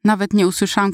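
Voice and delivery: ordinary delivery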